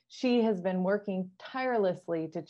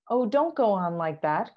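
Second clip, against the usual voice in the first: louder anxious cry, a touch of despair; Queen crying out; 2 semitones higher, 7 dB louder than usual. Acted. Queen pleading with tearful Alice, raised anxious cry. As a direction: plead with her to stop, voice raised. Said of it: "cried the poor queen".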